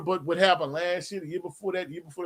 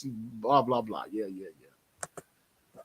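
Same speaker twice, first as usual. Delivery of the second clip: dismissive brush-off; 8 semitones lower, 8 dB quieter, much slower than usual. Real, not acted. Brushing off insulting chat comment.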